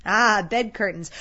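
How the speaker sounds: loud, slow, drawn-out "Ah", then falling; brazen charwoman confirming. Acted.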